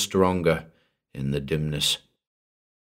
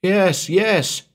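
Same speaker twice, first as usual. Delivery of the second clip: groaned; 13 semitones higher, 4 dB louder than usual. Acted. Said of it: "she groaned".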